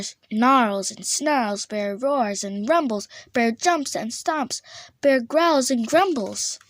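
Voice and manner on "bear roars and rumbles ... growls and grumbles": ordinary delivery, nothing marked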